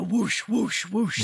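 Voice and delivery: swooping whoosh sound effects; imitated whoosh. Narrator voicing a huge shadow passing behind her, swooping whooshes. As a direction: whoosh like something huge sweeping past.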